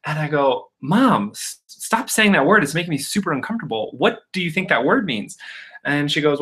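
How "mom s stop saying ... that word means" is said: high-pitched, mortified plea to mom; mock-exasperated scolding; retold exasperation. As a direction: act out an embarrassed grown child protesting. Embarrassed child scolds mom in story.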